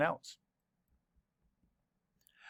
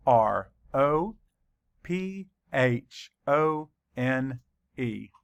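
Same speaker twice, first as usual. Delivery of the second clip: normal talking voice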